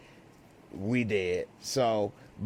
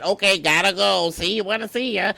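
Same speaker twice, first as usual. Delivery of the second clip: higher and louder, rushing out the door; a quick singsong goodbye at quitting time; eager to leave work; 8 semitones higher, 6 dB louder than usual. Retold or imitated. Worker dashing out at three o'clock, bright rushed goodbye. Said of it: "can't wait to get off work".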